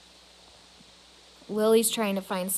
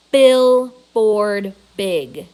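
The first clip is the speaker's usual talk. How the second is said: slow, loud, expansive; thrilled sweeping proclamation; grand and drawn-out; 12 dB louder, much slower than usual. A narrator's reading. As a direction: stretch it out big. Julia arms spread wide.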